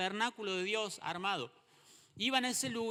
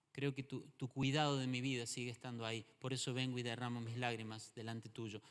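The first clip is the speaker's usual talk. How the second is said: softer, hushed voice of someone praying; quiet prayer; 6 semitones lower, 5 dB quieter than usual. Acted. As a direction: speak the prayer gently to God. Voices believer's prayer to God.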